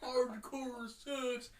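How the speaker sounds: very slow, drawn-out words; running-gag slow delivery; mock-intense. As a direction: stretch each word right out.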